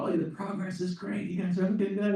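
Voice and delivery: high-pitched call from a passing truck; imitated yelling out. Kooky driver encouraging the builders, imitated yell. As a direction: mimic an enthusiastic passer-by calling up, without full volume. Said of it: "he yells out again".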